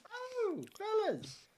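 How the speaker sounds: high fussy cleric voice; high character voice. Acted. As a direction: pitch it way up for the cleric. Cleric greets party in character.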